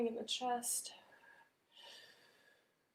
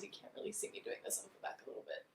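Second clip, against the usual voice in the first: whispered aside; 13 dB quieter, much faster than usual. Real, not acted. Aside about the camera view.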